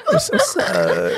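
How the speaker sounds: slow, high, laughing greeting; mock flirty hello. Friend copies the flirty phone hello, slow and high. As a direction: stretch the hello out playfully. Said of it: "I'd be like".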